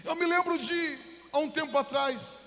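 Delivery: preacher building intensity; preaching. Acted. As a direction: proclaim it to the congregation. Opening of sermon, raised voice.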